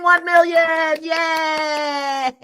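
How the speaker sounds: a loud, high, joyful cry; excited cheer. Real, not acted. Host cheering her viewers at the launch, loud and joyful. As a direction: cheer the launch like a crowd would.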